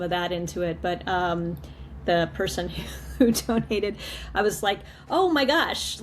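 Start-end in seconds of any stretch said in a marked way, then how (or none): 5.1-6.0 surprised. Retold or imitated. Retells her surprise at donation.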